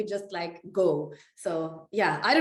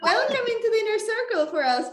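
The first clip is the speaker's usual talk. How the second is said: high-pitched, laughing cheer of welcome; celebratory, voice lifted high; excited welcome; 4 dB louder than usual. Real, not acted. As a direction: welcome a winner with real glee. Cheering a newly joined winner.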